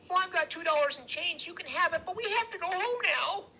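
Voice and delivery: put-on very high little kid's voice; Freak pleading. A narrator's reading. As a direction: bargain to get away, very high. Freak bargains in story.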